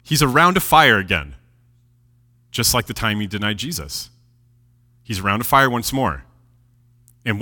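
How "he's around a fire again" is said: ordinary talk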